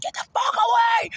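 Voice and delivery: very high full-throated shout; reenacted scream. Retelling how he screamed at the man to leave, screaming. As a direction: scream it to send someone running. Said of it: "And I scream it".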